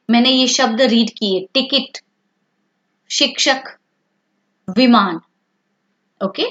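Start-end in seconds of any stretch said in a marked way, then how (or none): 1.5-5.2 short i in टिकट, शिक्षक, विमान; teacher's word-by-word टिकट, शिक्षक, विमान with short i. Shown as a demonstration. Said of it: "उसका उच्चारण हमेशा छोटा होता है".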